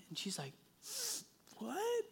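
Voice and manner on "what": soft, high-pitched surprise; quiet disbelief. Retold or imitated. Daughter told she won a trophy, soft high disbelief. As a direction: ask it small and high, stunned.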